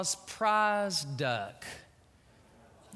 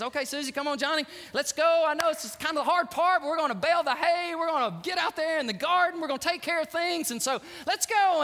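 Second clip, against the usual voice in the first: loud, higher, energetic call to work; rallying; 7 semitones higher, 3 dB louder than usual. Acted. Granddad sends kids out to farm work, rallying. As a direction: round up the kids for chores.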